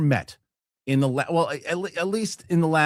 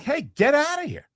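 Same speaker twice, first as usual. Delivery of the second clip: shooing the dog; 10 semitones higher than usual. Retold or imitated. Shooing off a dog nipping daughter.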